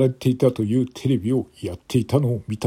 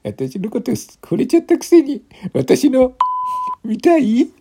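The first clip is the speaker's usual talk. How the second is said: put-on dead drunk woman; 13 semitones higher, 3 dB louder than usual. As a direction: coo it, tipsy and flirting. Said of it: "一人の泥酔した女性が".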